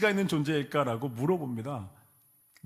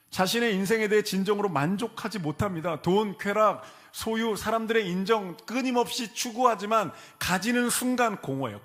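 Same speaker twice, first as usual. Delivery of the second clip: raised, louder sermon build; fervent preaching; 7 semitones higher, 4 dB louder than usual. Acted. Preacher stirring the congregation, fervent and loud. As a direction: press the congregation hard, rising.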